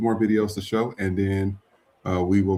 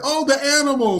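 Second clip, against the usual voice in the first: high mock gasp over the animal; mock shocked shooter; 4 dB louder than usual. Mocking shooters who'd spare a dog, high squeal. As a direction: squeal it like they'd spare the pet. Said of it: "They be like".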